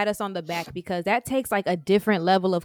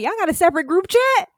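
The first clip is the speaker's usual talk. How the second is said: higher, louder, quick disbelief; mock outrage; 8 semitones higher, 6 dB louder, faster than usual. Friend pretending to be shocked by the secret group chat. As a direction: fake betrayal, playful. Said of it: "I'm like".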